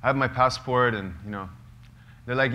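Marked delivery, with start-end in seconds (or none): 0.0-0.9 loud, higher pleading for attention; calling out. Retold or imitated. Prisoners calling out to police, loud and higher. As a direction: get the guards to notice the Americans. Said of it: "we're like".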